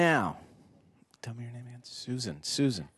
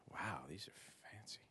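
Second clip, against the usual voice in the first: soft unvoiced whisper aside; 2 semitones lower, 19 dB quieter than usual. Real, not acted. Speaker fiddling with gear, whispering to himself. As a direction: whisper it to yourself.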